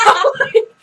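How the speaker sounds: high, loud laughter over the words; real laughter at her friend's blunder; bursting laugh. Real, not acted. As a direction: crack up mid-sentence. Laughing at the word mix-up.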